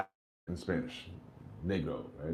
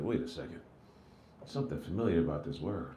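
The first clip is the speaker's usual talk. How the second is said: quiet suspicious; 10 semitones lower, 11 dB quieter than usual. Retold or imitated. Suspicious realization.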